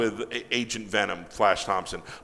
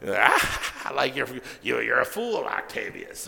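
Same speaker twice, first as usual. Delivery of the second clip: mock gloating Goblin; 2 semitones higher than usual. Goblin mocking Doc Ock, lightly acted gloating. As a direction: imitate a gloating, laughing villain. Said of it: "is just laughing".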